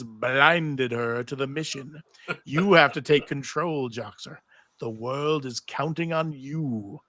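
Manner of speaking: slow, heavy voice of Orpheus's severed head; slow ominous head. Acted. Narrator voicing Orpheus to Xena, slow and ominous. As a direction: drag each word out like a scheming head.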